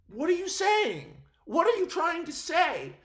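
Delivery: pitched well up, asking in mock confrontation; incredulous challenge. Vlogger questioning the filmmakers' choice, in incredulous challenge. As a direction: demand to know what they mean. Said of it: "and it was like".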